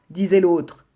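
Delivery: ordinary delivery, nothing marked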